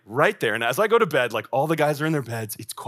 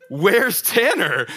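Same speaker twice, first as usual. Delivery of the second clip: alarmed; 8 semitones higher, 6 dB louder, much slower than usual. Retold or imitated. Finds empty hallway, door open.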